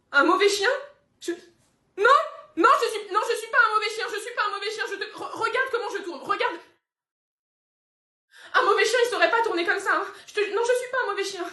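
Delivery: high, loud, desperate pleading; pleading dog. Acted. Scolded dog protesting to its owner, high desperate pleading. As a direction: beg not to be a bad dog.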